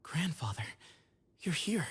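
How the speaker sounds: breathless whisper of "Grandfather"; hushed, startled whisper; whispered surprise. Acted.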